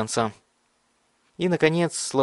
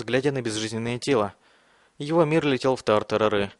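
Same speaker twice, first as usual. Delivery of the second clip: usual speaking voice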